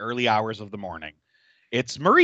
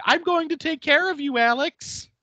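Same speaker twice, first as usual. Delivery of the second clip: high, sing-song threat; mock menacing Marie. Marie chasing Alex with a saw, high sing-song taunt. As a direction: taunt her sweetly while chasing.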